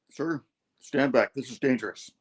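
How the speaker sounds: steady, serious command; serious hero. Retold or imitated. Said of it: "Spider-Man's like".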